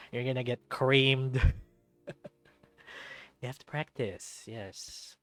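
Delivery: usual speaking voice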